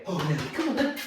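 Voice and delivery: egging the dog on; playful hurry-up call; exciting the dog. Real, not acted. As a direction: rouse the dog with eager coaxing. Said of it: "try and make Nelly now to get excited".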